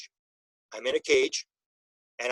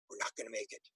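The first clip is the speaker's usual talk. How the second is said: near-whispered, much softer; hushed despair; 5 semitones lower, 17 dB quieter, faster than usual. Retold or imitated. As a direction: barely voice it, as if hope is gone. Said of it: "and she says".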